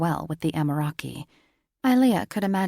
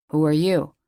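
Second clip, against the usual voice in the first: gruff uncle demanding; 7 dB louder than usual. A narrator's reading. Uncle confronts stranger.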